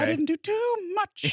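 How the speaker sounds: high, drawn-out singsong mimicry; mocking echo. Co-host teasing his friend's week, mocking echo. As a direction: parrot it back in a silly high voice. Mocking co-host's words back.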